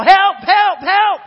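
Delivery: desperate shouts for help, pitched high; yelling for help. Retold or imitated. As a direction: scream it like a man trapped with a lion. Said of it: "he just starts to yell".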